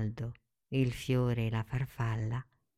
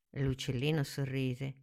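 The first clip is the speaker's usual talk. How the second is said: usual speaking voice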